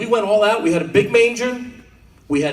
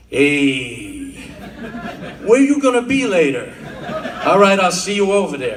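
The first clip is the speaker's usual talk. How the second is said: wiseguy voice; 2 semitones lower than usual. Pretend. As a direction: play a wiseguy setting up a meet. Nun's ABC as mob slang.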